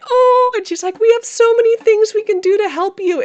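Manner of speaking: high, loud, sing-song caring voice; high sweet reassurance. Retold or imitated. Retelling the nurse reassuring her, high sweet sing-song. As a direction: coo it like a cheerful nurse.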